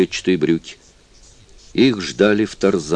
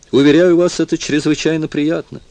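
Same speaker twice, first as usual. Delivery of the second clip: ironic assurance; 10 semitones higher than usual. A narrator's reading. Jeremy telling Propter about his solitude, wryly ironic. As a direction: say it with dry irony.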